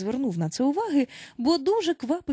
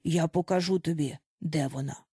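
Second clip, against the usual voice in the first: calm; 5 semitones lower than usual. A narrator's reading. Bazika offers to show Peter Wendy, calm and low. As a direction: offer it evenly in a lowered voice. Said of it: "спокійно заговорив він".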